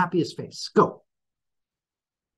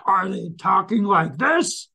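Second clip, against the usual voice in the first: pitched up and drawn out; high, slow demo voice; 7 semitones higher, slower than usual. Shown as a demonstration. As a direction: show a slow sing-song tone. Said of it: "Do they sound like this?".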